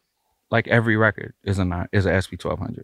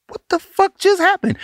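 high-pitched mock astonishment; stunned disbelief; 4 dB louder than usual. Producer retelling a rapper's shock at the verses, stunned. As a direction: imitate someone floored, pitch shooting up. Acting out [name]'s stunned reaction.

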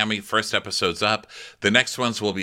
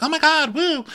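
very high, louder "woo"; mock party cheer; 4 dB louder than usual. Host imitating guests cheering. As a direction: cheer like a guest jumping in the water. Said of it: "typical, like".

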